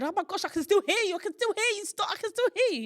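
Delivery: loud, high, rushed mock-panic outburst; retold panic. Patient telling the anaesthetist she's still awake, panicked.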